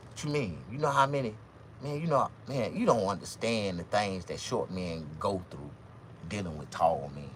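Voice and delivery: normal talking voice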